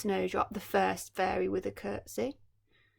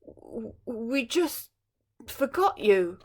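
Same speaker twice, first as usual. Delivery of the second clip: stammering; 2 semitones higher, 5 dB louder, slower than usual. Acted. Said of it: "the king stammered".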